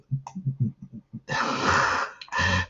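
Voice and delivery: breathy self-mocking laugh; laugh at own stumble. Real, not acted. Tutor losing his thread on coherence, breathy laugh. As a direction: break off and laugh at yourself.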